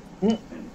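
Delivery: a short high laugh of surprise; high breathy laugh. Real, not acted. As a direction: laugh it off, amused. Reacts to the GM's crone line.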